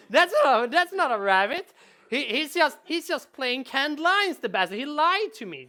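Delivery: high, loud betrayed protest; hammed-up indignation of a duped player; mock outrage.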